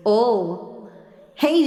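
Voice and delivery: usual speaking voice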